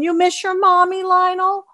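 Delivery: high-pitched cooing question to a dog; pet talk. Real, not acted.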